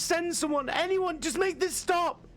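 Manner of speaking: high, frantic begging; desperate plea. A narrator's reading. Trapped woman begging the court to send help, frantic and high. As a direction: beg for anyone to come, voice cracking with panic.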